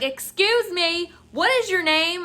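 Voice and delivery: high, loud entitled customer voice; demanding Karen. Retold or imitated. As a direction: imitate an outraged customer demanding an answer. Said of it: "Fucking Karen showed up".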